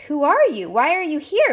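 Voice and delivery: Big Pug confronting the monster, demanding. Acted. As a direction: demand answers from the stranger. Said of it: "Big Pug demanded".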